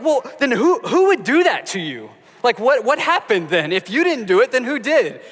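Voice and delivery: high, loud, exaggerated incredulous dad; mock disbelief. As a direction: ham up a baffled father questioning. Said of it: "And I'm like".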